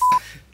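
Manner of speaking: high bright laugh after the joke; burst of laughter. Real, not acted. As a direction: laugh at your own joke. Laughs after joking boast.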